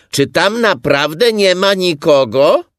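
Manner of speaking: high, loud shouted question; loud, high call. A narrator's reading. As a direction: holler into the hole for an answer. Pooh calls into the burrow.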